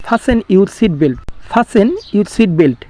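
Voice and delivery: Fasten your seat belt said very slowly and clearly; slow model sentence. Shown as a demonstration. Teacher modelling English for students, slow and clear. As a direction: spell it out slowly for learners. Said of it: "কিভাবে ইংলিশে বলবো".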